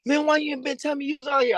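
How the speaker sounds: high, fast, half-joking disbelief; surprised coach. Retold or imitated. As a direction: coach on the phone, amazed he's nearby, quick and high. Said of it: "Coach Tuck called me".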